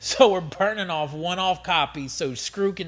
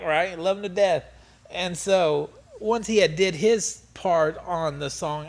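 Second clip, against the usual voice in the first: ordinary talk, nothing marked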